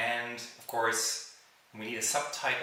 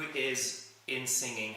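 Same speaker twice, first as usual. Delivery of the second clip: normal talking voice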